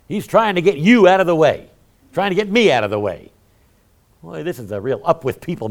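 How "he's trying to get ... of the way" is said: emphatic. Real, not acted. Emphatic sermon climax.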